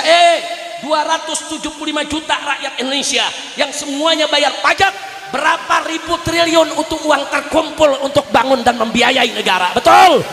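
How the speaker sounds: forceful rally sermon rising to a shout; impassioned orator rousing the crowd; fiery preaching. Real, not acted. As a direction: build insistently to a loud, high takbir.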